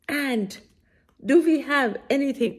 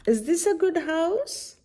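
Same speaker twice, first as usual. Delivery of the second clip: bear asking; 7 semitones higher than usual. Acted. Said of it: "the bear is asking".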